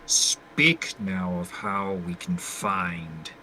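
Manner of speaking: low, slow, hushed monologue with long pauses; restrained, burdened character. Acted. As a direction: speak softly and deep, holding it in. Plays his character's monologue.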